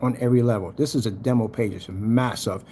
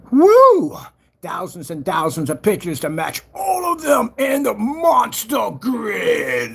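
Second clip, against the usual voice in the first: excited shout; 8 semitones higher, 4 dB louder, slower than usual. Real, not acted. Excited over big demo page.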